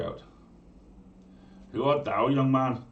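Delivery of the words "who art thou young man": loud, high challenge from the smith; gruff smith. Acted. Smith challenging a stranger at night, loud and high. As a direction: call out to the stranger at the door.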